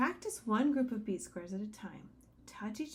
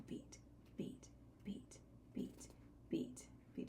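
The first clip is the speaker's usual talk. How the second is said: whispered beats; 4 semitones lower, 15 dB quieter, slower than usual. Shown as a demonstration. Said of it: "as I sing".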